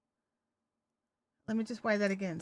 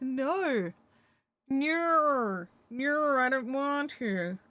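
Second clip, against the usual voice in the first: louder, slow stubborn dog voice; dog refusing; 3 semitones higher, 5 dB louder, much slower than usual. Pretend. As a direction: speak for the dog, dragging a stubborn no. Voicing the dog's refusal.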